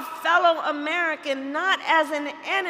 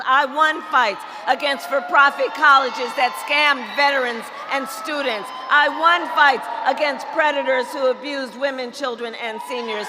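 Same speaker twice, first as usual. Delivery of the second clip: ordinary delivery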